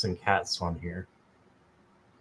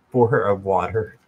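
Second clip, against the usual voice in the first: laughing at herself; 3 semitones lower than usual. Real, not acted. Laughing after tripping over words.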